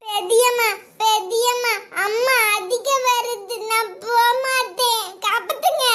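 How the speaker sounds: high, slow, singsong whimper; frightened child's plea. Acted. Small child begging aunt to save him from mother, whimpering plea. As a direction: wail for help like a little child.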